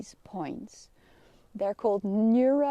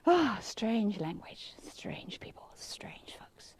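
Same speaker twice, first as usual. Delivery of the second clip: whispered aside; 6 dB quieter, slower than usual. Real, not acted. Teasing aside about the Dutch.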